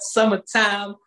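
ordinary talk, nothing marked

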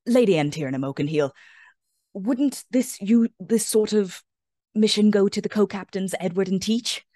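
nervous goblin stage whisper; loud stage whisper. Acted. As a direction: hiss it loudly so all can hear. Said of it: "he whispers quite loudly".

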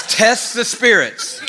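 slow, high, loud recitation; scripture cited. Shown as a demonstration. Preacher quoting the Bible to the crowd, slow and emphatic. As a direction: announce the verse slowly. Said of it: "It says".

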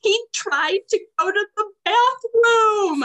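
high, loud, tearful telling; crying kid report. Retold or imitated. Crying student reporting the bathroom, tearful and high. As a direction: blurt it out like a sobbing kid. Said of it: "And he said".

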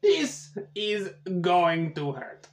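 slow, hammed-up Russian accent drifting into French; Russian accent slipping French. GM retries the Russian specialist, accent sliding slow toward French. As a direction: try for Borat, land somewhere near Paris. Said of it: "that went a little French".

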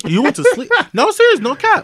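loud, high mock scolding that breaks into a grin; rising indignant call-out; anger turning to laughter. Scolding listeners, rising heat.

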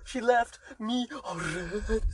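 mock sobbing.

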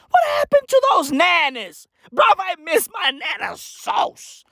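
high, shouted comic complaint; exasperated, high-pitched holler; wailed lament. Acted. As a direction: cry out for the lost nanas. Comic lament for old nanas.

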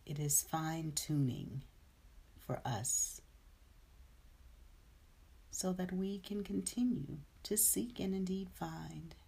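soft, hushed meditation guide; guided meditation. Acted. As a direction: ease them into stillness, softly.

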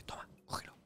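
hushed backstage whisper. Retold or imitated. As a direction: whisper it so the audience can't hear. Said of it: "y me dice".

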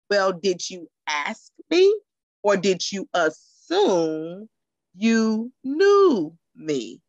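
slow and firm rhetorical question; slow pointed challenge. Real, not acted. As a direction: lean on it slowly, daring them to answer.

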